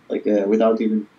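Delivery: ordinary talk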